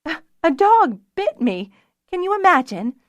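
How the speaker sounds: high, loud, hysterical amusement; laughing. A narrator's reading. Fiona finds her dog bite funny, laughing. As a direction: let laughter break through the words. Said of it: "She started laughing".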